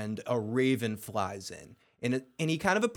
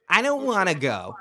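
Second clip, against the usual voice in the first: wise-ass whine; 5 semitones higher, 4 dB louder than usual. Retold or imitated. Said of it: "He says it like a wise ass".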